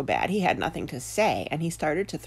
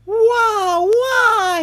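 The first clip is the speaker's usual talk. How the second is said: two long, high, loud wails; bather's screamed "waah"; terrified scream; 11 dB louder than usual. A narrator's reading. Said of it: "he screamed".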